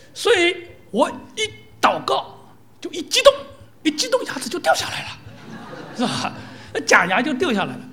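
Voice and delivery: mock excited old pastor. Old pastor explaining his loose false teeth, mock excitement. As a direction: build up, laughing, as the old man explains. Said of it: "他说".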